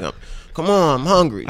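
pleading, hammed-up whine; Birdman whining. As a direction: imitate a hungry man nagging to leave. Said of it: "he's, like, whining".

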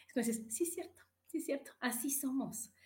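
whispered, confiding "sí es cierto"; hushed proud confession. Real, not acted. Confiding aside to the audience.

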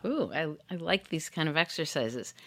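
ordinary talk, nothing marked

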